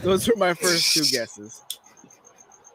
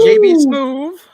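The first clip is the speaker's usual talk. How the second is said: high, loud excited name call; loud hype call; 9 dB louder, slower than usual. Acted. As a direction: shout the name out like a hype man. First fast hype take.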